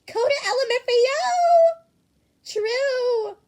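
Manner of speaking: shrieking at the top of the lungs; loud shout. Acted. Kaminari screaming in the group chat, wild shout. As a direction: scream it out, cracking high. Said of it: "How are you so loud even through chat".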